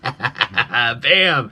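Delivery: punchy exclaimed bam; Red Green catchphrase. Retold or imitated. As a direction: bark it out like a TV host. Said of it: "like they do on Red Green".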